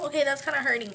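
normal talking voice

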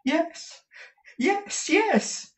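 very high, excited yeses; high-pitched mounting eagerness; increasingly eager. A narrator's reading. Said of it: "cried Smike with increasing eagerness".

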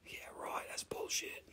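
under-the-breath scoffing whisper; sly whispered dismissal; whispered aside. Real, not acted. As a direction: whisper it so only chat hears. Muttered aside to the chat.

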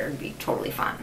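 breathy conspiratorial whisper; whispered aside about partying. Real, not acted. Aside after party invite.

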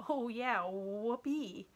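understated sarcastic cheer; mock enthusiasm. A narrator's reading. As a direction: feign excitement, unimpressed. Sarcastic boy mocks backstage jobs.

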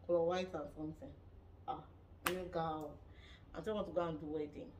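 usual speaking voice

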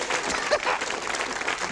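squealing breathy giggle; high silent laugh; high wheezy laugh. Real, not acted. High laugh after joke.

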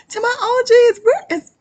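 high, loud squealing shout; squealing call. Pretend. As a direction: squeal it out to the fans. Calling out to fans.

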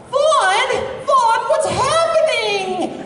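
put-on very high, slow boyish voice; Yuri Lowenthal impression. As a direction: pitch way up like an annoying kid. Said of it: "my best Yuri Lowenthal".

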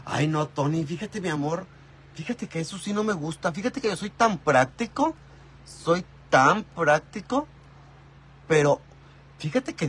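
a hint of a fussy señora; mock señora voice. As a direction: play a prissy housewife lecturing Tony. Mock fussy señora talking to Tony.

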